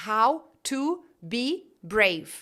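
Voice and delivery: very slow HOW, TO, BE, BRAVE; separated words. Shown as a demonstration. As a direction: say "how to be brave" with every word apart. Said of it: "se eu falar separado".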